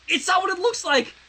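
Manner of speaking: high, loud, panicked protest. Acted. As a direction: blurt it out in a panic. Sunset panics, caught kissing.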